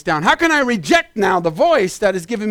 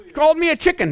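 high-pitched mock protest; mock complaint; 7 semitones higher, much faster than usual. Listener who mishears the message, high mock complaint. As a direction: pipe it up like an affronted listener.

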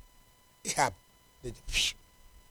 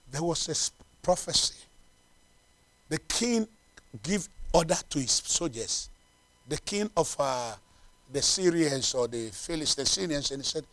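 ordinary talk, nothing marked

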